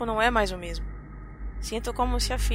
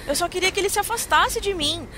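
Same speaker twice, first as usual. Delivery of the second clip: raised, louder panicked telling; panicking; 6 semitones higher, 4 dB louder than usual. Acted. Frightened woman recalling her attempt to flee the man, panicking. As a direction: build from dread into frantic fear.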